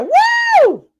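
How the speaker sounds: loud, very high "woo" cheer; high excited whoop. Real, not acted. Livestreamer whooping for Ambrosia, high loud whoop. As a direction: let out a piercing fan whoop.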